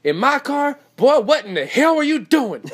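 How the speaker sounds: very high, loud mom outburst; ad's outraged mom. Retold or imitated. Mom hearing her son wrecked her car, outraged mock yell. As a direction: shriek it like a scandalized mother. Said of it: "She goes".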